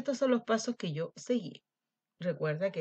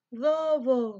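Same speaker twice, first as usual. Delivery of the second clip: loud, higher call of the name; shouting; 6 semitones higher, 8 dB louder than usual. Acted. Lamb knocks and shouts for the wolf, loud call. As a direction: call out through the door. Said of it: "gritó".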